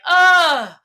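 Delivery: an exaggerated, sarcastic "ugh". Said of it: "as sarcastically, like".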